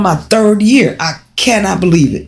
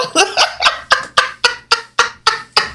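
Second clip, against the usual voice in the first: airy high-pitched giggle; high breathy laugh; 4 dB quieter than usual. Real, not acted. Laughing at her own voice.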